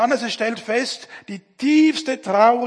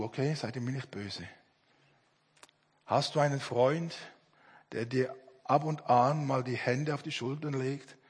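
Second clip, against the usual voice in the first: low, soft and confiding; real tender, quiet appeal; gentle personal question; 8 semitones lower, 8 dB quieter than usual. Real, not acted. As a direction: drop right down, as if asking one person privately. Preacher asks listeners a personal question.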